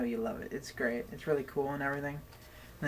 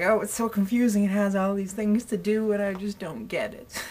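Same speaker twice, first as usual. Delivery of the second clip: higher, louder put-on complaint; mock confused old person; 6 semitones higher, 5 dB louder than usual. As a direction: whine like a baffled oldster, ending in a laugh. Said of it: "I feel so old about it".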